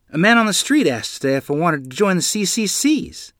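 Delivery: eager, lifted voice with news; a boy telling his mother about a job offer; excited. Acted. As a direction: burst in with the good news. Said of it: "I said excitedly".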